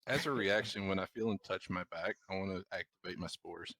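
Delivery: ordinary delivery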